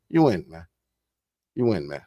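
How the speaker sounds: dropped low and quiet. Real, not acted. Speaker signing off to viewers, low and soft. Repeated tag line fading out.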